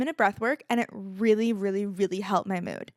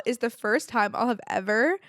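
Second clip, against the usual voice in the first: ordinary delivery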